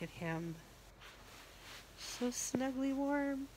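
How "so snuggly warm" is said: cooing. Real, not acted. Cooing over baby doll.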